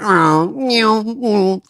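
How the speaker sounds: high mewing sound effects; squeaky meows for the stars; mock meow effects. Driver's eclipse demo, high playful meows. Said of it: "I like those effects".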